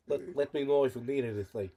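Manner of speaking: British imitation that slips toward Russian; botched British accent. Friend mocks the shop girl's greeting, botched British accent. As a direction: badly fake a British accent that comes out Russian. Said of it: "Sorry, she's Russian".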